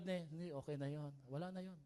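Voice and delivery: quietly waving it off; acted gentle dismissal of a grudge; soft forgiving reassurance. Acted forgiving reply after an apology.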